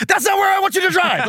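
high-pitched mock outburst; comically exasperated yell; losing his cool. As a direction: suddenly blow up, voice leaping way up. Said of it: "he loses his cool again".

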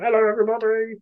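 high, strained mock greeting; mock stage greeting. Imagining himself greeting a crowd with a hot water bottle, silly high voice.

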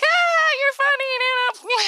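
high, bright laugh-it-off to grandma; fake laugh-off. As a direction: brush it off sweetly, way up high. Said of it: "did the whole Lindsay thing".

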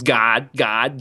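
normal talking voice

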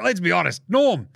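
usual speaking voice

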